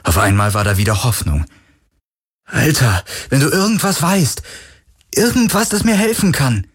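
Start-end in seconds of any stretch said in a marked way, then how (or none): 2.5-6.7 desperate pleading. Acted. Galen begs the ghost for answers.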